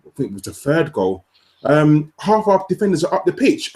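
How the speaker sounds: ordinary talk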